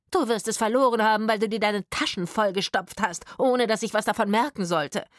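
loud, high, scolding tirade; domineering accusation. A narrator's reading. Woman accusing partner of hiding money, angry scolding. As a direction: lay into him loudly. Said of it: "herrschte die Frau ihn an".

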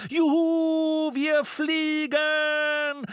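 high, long-drawn whoop of joy; exultant shout. A narrator's reading. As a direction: shout it high, stretching every word in delight. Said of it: "rief Jamiro". (lang gezogener, hoher Jubelschrei)

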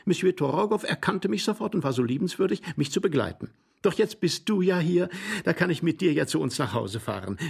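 high, gushing lady's voice; excited lady. Acted. Glafira tells husband of her sleigh accident, excited and high. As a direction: pour out the story breathlessly high.

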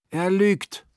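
laut und höher, empört hingeworfen; scharfer Vorwurf. Acted. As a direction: klag ihn mit einem Schlag an. Großmama accuses the Sonderling. (Großmama beschuldigt den Sonderling laut und empört)

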